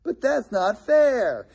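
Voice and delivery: put-on high, slow child's whine of "But that's not fair"; whiny kid. Preacher imitating his child protesting unfairness, whiny. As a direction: mimic a little kid protesting. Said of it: "One of our kids said once".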